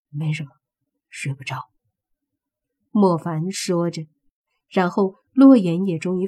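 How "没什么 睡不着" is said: hushed whisper, trailing off. Acted. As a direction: whisper so nobody else hears. Mo Fan answers in the dark.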